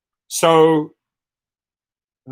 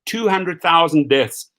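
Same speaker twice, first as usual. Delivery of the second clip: usual speaking voice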